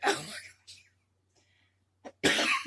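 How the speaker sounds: usual speaking voice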